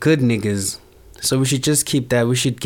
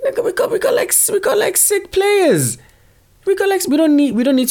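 excited squeal; 3 dB louder than usual. Real, not acted. Bragging about an all-Africa team, sudden high excited squeal.

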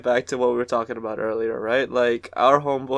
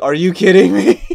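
louder, raised incredulous outburst; disbelieving exclamation; 4 semitones higher, 9 dB louder than usual. Retold or imitated. Reacting to a dumb question with loud disbelief. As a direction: throw it out in disbelief.

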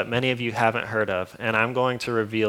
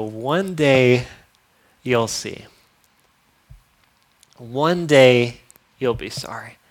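mock warning; 4 dB louder, slower than usual. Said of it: "I wanna go".

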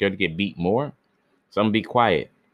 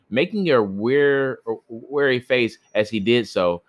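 plain reading voice

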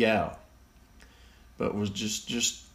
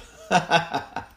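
real laugh; 8 semitones higher, 4 dB louder than usual. Real, not acted. Laughs at silly definition.

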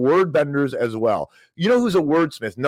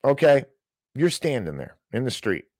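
ordinary talk, nothing marked

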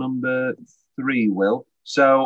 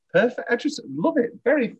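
gushing; 12 semitones higher than usual. Real, not acted. Gushing about favourite episode.

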